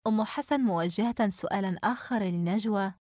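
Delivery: ordinary delivery, nothing marked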